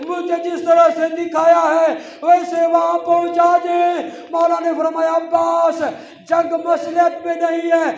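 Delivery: impassioned majlis recitation; preaching at full force. Acted. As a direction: drive the scene with loud grief. Preacher's shouted lament.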